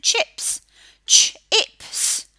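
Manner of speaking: chips, then Ch-ips split in two; sounding out words. Shown as a demonstration. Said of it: "how Miss Emma says it".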